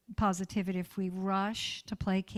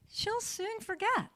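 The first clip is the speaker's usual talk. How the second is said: she'll soon forget said lovingly and high; tender; 13 semitones higher than usual. Acted. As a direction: soothe like rocking a child. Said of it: "repeats line".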